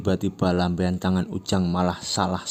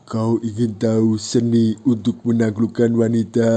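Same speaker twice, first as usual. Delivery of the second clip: louder, swaggering offer; cool and confident; 3 semitones higher, 7 dB louder than usual. Acted. Ucok boasting to Ujang, cool swagger. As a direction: sound like you know the secret. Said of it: "Dengan gagah dan keren Ucok".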